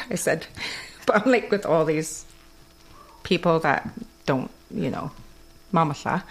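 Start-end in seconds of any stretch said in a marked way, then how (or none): none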